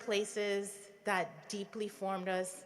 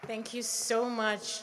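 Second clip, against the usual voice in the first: ordinary talk, nothing marked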